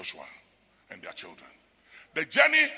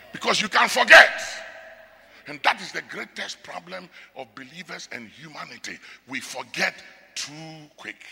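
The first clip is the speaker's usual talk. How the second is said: loud, ringing pulpit warning; full-voiced sermon delivery; preaching at full force; 9 semitones higher, 4 dB louder than usual. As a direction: thunder it at the congregation like a preacher. Preaching on Israel's ingratitude.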